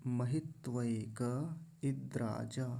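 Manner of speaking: 'महित्वैक इद्राजा' slowly, full 'vai-ka'; joined careful recitation. Shown as a demonstration. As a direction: link 'महित्वैक इद्राजा' with no nasal before d. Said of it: "दोनों को मिलाएंगे".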